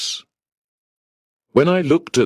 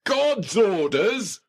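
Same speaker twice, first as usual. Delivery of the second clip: furious shout; 13 semitones higher, 4 dB quieter, slower than usual. Acted. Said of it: "I shouted, furious".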